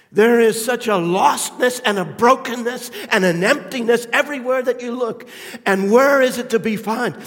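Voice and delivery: loud, high, ringing sermon climax; preaching at full force. Acted. Preacher to congregation, loud impassioned build. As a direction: preach it out with rising fervor.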